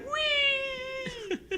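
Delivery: put-on high thin whee of a child; mock squeal. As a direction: squeal like a kid on a ride.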